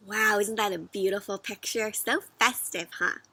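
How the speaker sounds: ordinary delivery, nothing marked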